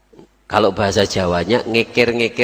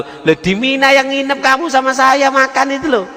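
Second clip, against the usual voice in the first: high, excited protest; you were right there with me; amazed insistence. Acted. Second friend insists, amazed. (teman kedua heran dan bersemangat)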